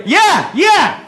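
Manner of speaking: annoyed yeah yeah. Retold or imitated. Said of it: "replying to stranger".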